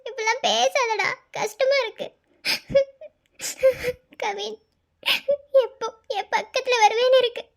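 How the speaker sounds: pleading through tears; tearful. Acted. Wife longing for absent husband to return, tearful. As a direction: scold him, then plead, sniffling between phrases. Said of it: "எதுக்கு அழுதுட்டே இருக்க?".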